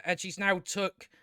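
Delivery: ordinary talk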